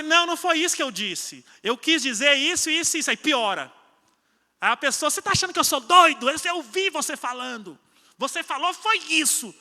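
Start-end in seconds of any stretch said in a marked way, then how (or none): 0.0-3.1 higher, louder exasperated insisting; frustrated protest. Acted. Discussão de casal encenada. 5.1-9.5 high, loud, fast offended outburst; angry retort. Acted. Briga de casal encenada.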